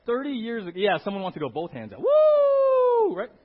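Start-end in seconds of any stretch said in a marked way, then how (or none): 2.0-3.2 cheer. Real, not acted. Cheering with hands out.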